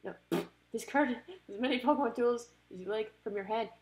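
ordinary talk, nothing marked

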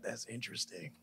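very low, soft stock reply; noncommittal murmur. Retold or imitated. Dad humouring daughter's chatter, noncommittal murmur. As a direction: murmur it as if half listening.

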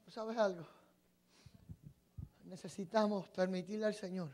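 normal talking voice

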